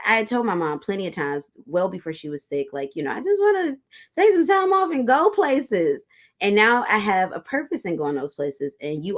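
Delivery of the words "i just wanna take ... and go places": much higher, slowed-down plea, louder at the end; wistful wish to mom. Retold or imitated. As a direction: lift the voice high and drag it out like a longing kid. Telling her mom her wish.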